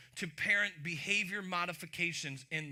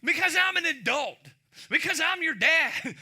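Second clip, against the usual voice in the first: loud and much higher, laying down the law; mock dad shout; 9 semitones higher, 11 dB louder than usual. Dad to his kid, pulling rank with a hammed-up shout. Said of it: "used that one".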